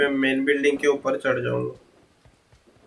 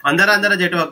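urgent call; 6 semitones higher, 4 dB louder than usual. Real, not acted. Urging teammate inside.

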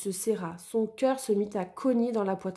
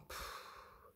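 voiceless weary puff of a sigh; 24 dB quieter than usual. Acted. Joshua sighing at the wolf's failed yoga, breathy puff. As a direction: blow out a tired sigh. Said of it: "soupira Joshua".

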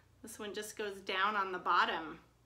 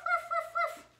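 high yappy woofs from the puppet; puppy barking; 5 dB louder than usual. Acted. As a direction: bark like a small excited dog. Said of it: "Doggy is here today".

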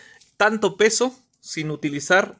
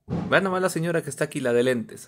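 ordinary talk

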